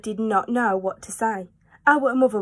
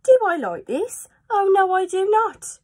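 high, loud mock-crying fish; high wailing fish complaint; upset fish; 10 semitones higher, 4 dB louder than usual. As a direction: wail in dismay. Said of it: "He said".

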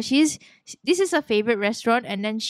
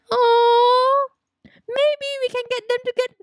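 excited idea; 12 semitones higher than usual. Real, not acted. Excited idea to marry them.

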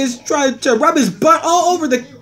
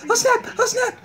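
"Oh, snap" pitched up high; 7 semitones higher, 3 dB quieter than usual. Acted. Reacting to what is on, high "Oh, snap".

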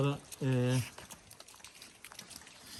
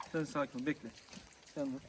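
normal talking voice

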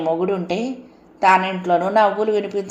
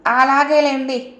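higher, louder and slow; loud dragged-out okay; loud reply; 8 semitones higher, 4 dB louder, slower than usual. A narrator's reading. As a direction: call back to the husband, drawn out. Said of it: "మొగుడికి గట్టిగా సమాధానం చెప్పి".

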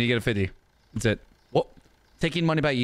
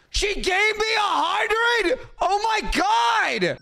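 shocked; 14 semitones higher than usual. Real, not acted. Shopkeeper shocked by a customer's hundred, high disbelief. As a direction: squeal it out in shock.

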